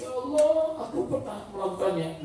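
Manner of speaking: acted lament, high cry sinking to a soft murmur; mock tearful regret. A penitent hears the word riba, tearful regret. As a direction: sigh it out as if near tears. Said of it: "Menangis dia".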